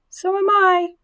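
very high, held piping voice; tiny third voice. A narrator's reading. Third spiderling chimes in to Wilbur, tiny high voice. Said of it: "said a third voice".

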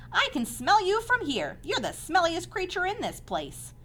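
high-pitched taunting lamb; rude lamb. A narrator's reading. Lamb insulting the pig about his smell, rude and high. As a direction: needle them in a high lamb voice.

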